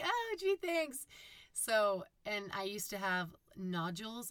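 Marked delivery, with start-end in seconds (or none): 0.0-1.0 high, loud mock gratitude; bright sarcastic "oh gee thanks". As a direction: say it with fake cheer after an insult. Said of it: "I was like".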